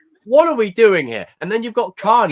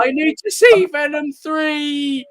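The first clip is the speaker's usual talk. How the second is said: higher, slow pleading fan voice; mock obsessed fan; 10 semitones higher, slower than usual. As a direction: whine like a desperate fan amid bombs. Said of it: "there's still be that one fan".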